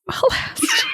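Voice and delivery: very high squeaky repeat; squeaky echo. Pretend. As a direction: squeak it back, then laugh. Echoing the spooky line.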